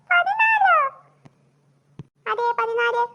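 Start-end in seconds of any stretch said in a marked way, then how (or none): 0.1-0.7 much higher, loud, stunned squawk; shocked disbelief. Acted. Student to teacher on hearing fourteen marks, shocked shriek. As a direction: shriek the number back in disbelief. Student repeats his low mark.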